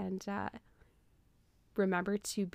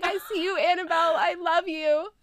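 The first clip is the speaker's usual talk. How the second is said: higher, a hint of crying on the name; mock-teary farewell from the boy; tearful goodbye; 9 semitones higher, 3 dB louder than usual. Billy saying goodbye, tearful.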